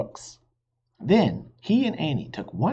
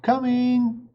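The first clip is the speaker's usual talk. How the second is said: high, loud answering shout; shouting back; 13 semitones higher, 4 dB louder than usual. A narrator's reading. Annie shouting out the window to Mom, high and loud. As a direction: yell it out the window. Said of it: "stuck her head out the window and shouted".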